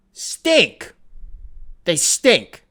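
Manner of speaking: mocking disgust. Real, not acted. Mocking Wizards.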